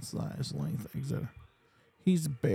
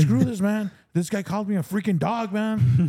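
higher and louder, imitating a fuming friend; hammed-up outrage, punch it out; mock angry rant; 7 semitones higher, 4 dB louder than usual. Fierrín angry the singer called him a dog, mock furious.

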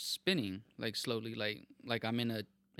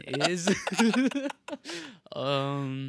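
laughing; 6 semitones higher, 9 dB louder than usual. Real, not acted.

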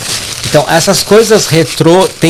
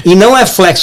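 ordinary talk, nothing marked

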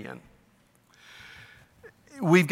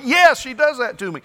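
high, loud blaming; mock defensive whine; 10 semitones higher, 6 dB louder than usual. Preacher plays the husband blaming his wife, high and loud. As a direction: push the blame back hard.

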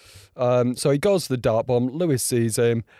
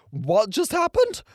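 disbelief; 14 semitones higher, slower than usual. Real, not acted. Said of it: "I'm sorry, what?".

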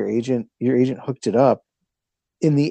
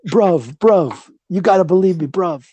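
mock British accent; 6 semitones higher, 3 dB louder than usual. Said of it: "He's out there like".

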